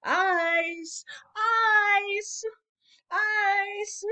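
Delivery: repeated high, loud street cry; street vendor's call. Acted. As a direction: hawk ice to the street. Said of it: "He says".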